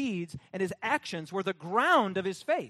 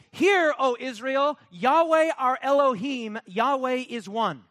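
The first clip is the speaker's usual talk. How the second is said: louder, slow proclaiming of the Shema; solemn recitation; 3 semitones higher, 7 dB louder than usual. Acted. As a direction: proclaim it as sacred scripture.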